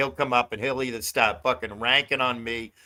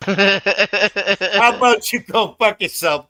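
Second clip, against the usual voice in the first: loud, high, drawn-out jibe; amused retold jibe; 6 semitones higher, 6 dB louder, much slower than usual. Retelling a jibe to Denny, loud and amused. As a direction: belt it out through amusement.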